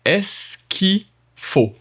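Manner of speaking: Est-ce qu'il faut slowly in four syllables. Shown as a demonstration. Teacher spelling the syllables out for students, slow. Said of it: "Four syllable".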